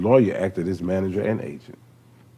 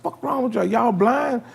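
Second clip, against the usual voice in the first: baffled at the crowd; 14 semitones higher, slower than usual. Retold or imitated. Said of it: "I'm like".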